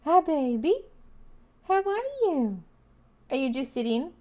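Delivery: high, soft sing-song voice for the cat; high soft pet talk to the cat. Real, not acted. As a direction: coo to the kitty. Talking to her cat.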